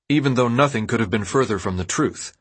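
ordinary delivery

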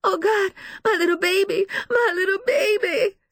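high, loud, anguished woman; sobbing. A narrator's reading. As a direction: plead and wail through tears. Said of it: "She sobs, hugging herself".

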